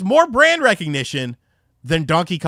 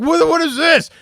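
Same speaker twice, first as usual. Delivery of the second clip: high, loud angry shout; sharp, loud shout; dad yelling; 11 semitones higher, 6 dB louder than usual. Retold or imitated. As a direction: yell it like a furious dad. Dad yelling at kids over dent.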